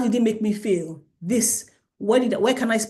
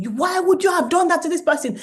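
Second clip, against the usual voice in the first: higher-pitched, rushed parental scolding; mock shouting; 8 semitones higher, 4 dB louder, faster than usual. Mom screaming at child who hit someone, mock shouting. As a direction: act out a mom yelling at her child. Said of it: "the mom is shouting, you're screaming".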